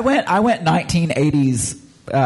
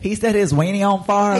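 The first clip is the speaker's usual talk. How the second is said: mimicked Southern twang, raised in pitch; Southern accent; 6 semitones higher than usual. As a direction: echo the Southern accent as a question, higher. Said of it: "That is a Southern accent right there".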